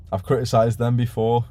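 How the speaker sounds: usual speaking voice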